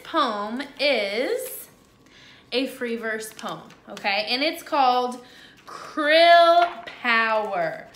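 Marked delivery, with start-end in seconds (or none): 5.7-7.9 slow, louder dramatic title announcement. Shown as a demonstration. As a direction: announce it like a big show.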